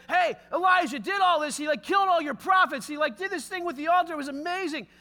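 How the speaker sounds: loud, breathless report to his wife, rushing; excited tattling. Retold or imitated. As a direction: blurt the news out all worked up. Ahab runs to tell Jezebel.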